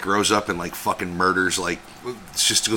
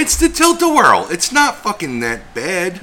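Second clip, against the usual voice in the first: loud, high-pitched protest; mock exasperated outburst; 10 semitones higher, 4 dB louder than usual. Baffled by a kid screaming on the ride, mock exasperated.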